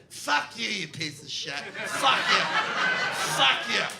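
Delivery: put-on loud drunk woman cursing the bouncer; drunken screaming. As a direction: yell it out, sloppy and furious.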